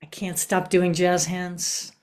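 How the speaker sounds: usual speaking voice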